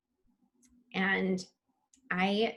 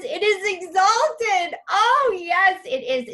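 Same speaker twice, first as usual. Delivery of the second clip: much higher and louder, slowed and soaring; exalted; 10 semitones higher, 5 dB louder, slower than usual. Real, not acted. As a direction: lift each word up in rapture. Rapture at a chat message.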